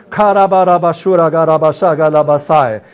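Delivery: fluent, flowing glossolalia; praying in tongues. Real, not acted. Preacher praying in tongues before the congregation, flowing and fervent. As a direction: let the tongues pour out steadily.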